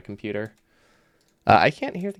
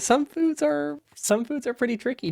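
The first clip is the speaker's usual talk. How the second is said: pitched up in playful complaint; high squeaky voice. Real, not acted. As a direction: voice jumps way up. Complains about eating with a retainer.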